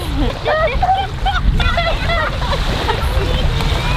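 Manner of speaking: high laughing shriek in icy water. Real, not acted. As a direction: squeal with laughter from the cold. Cold water launch.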